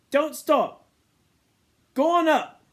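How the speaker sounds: high, loud urging of the smoke; urgent cheering on. A narrator's reading. Ralph urges the twins, loud and eager. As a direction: shout the fire upward.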